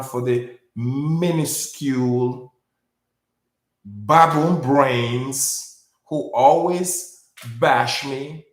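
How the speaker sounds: very slow, drawn-out condescending words; slow it right down to belittle them; slowed down mockingly. Real, not acted. Speaker to critics he calls baboon brains, condescendingly slow. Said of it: "let me slow it down".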